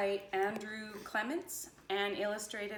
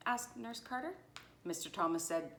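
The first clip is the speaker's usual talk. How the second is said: ordinary delivery, nothing marked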